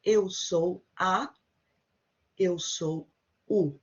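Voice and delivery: very slow and deliberate: eu sou a, eu sou o; slow model phrase. Shown as a demonstration. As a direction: spell it out slowly for learners. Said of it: "We tend to just pronounce u".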